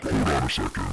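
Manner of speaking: low, crackly caller; mysterious client putting someone on hold; voice on phone. Acted. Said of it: "does the voice just be like".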